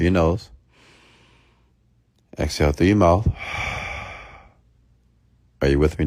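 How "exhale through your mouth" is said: long breathy exhale through the mouth; audible exhale. Shown as a demonstration. Coach modelling a calming exhale for viewers. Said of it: "take a deep breath".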